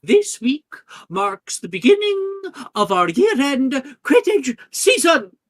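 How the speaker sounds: very loud, high announcement to the whole hall; headmistress announcing. Acted. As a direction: proclaim it grandly as the headmistress.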